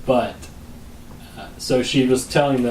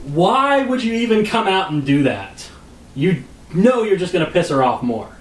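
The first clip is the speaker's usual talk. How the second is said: exasperated scolding; 5 semitones higher, 3 dB louder than usual. Retold or imitated. Husband berating the manager for angering his wife, exasperated. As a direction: scold in disbelief, pitch jumping up.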